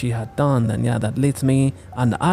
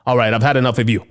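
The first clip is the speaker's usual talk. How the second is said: ordinary talk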